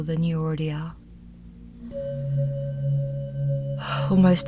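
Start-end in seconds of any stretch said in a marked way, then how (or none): 1.8-4.1 long, unhurried exhaled sigh; sigh of relief. Shown as a demonstration. Teacher showing listeners they have arrived, sigh of relief. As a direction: let out a slow sigh of relief. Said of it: "taking that sigh of relief".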